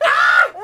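loud jump-scare scream; imitated startled scream. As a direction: mimic your own scream at a scare. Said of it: "And I'll be like".

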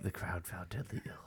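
soft whispered aside; low, voiceless murmur; hushed narration. Real, not acted. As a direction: whisper a comment on the silence. Hushed aside during call.

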